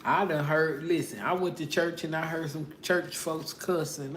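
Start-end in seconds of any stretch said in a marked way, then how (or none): none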